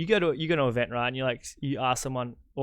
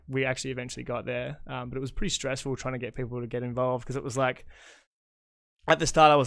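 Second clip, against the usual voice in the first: normal talking voice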